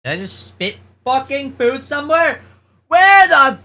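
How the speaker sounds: high loud startled outburst; suddenly alarmed; shocked. Real, not acted. Shocked at spitting food.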